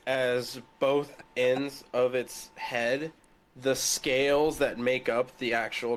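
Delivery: ordinary talk, nothing marked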